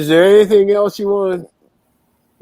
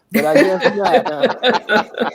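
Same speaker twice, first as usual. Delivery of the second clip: slow, chuckling tease; amused, slowed-down ribbing; teasing laugh; 3 semitones higher, much slower than usual. Real, not acted. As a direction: let the laugh leak through the words. Teasing guest about Emily story.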